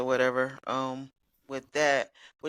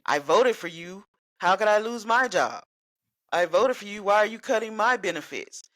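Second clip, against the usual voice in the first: indignant questioning; 6 semitones higher, 4 dB louder than usual. Retold or imitated. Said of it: "They're wondering".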